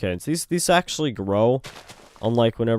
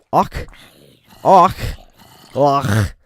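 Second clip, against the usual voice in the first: loud high barked Awk; 5 semitones higher, 9 dB louder, much slower than usual. Shown as a demonstration. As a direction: spit out Awk as a loud odd sound. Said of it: "It's awkward to say".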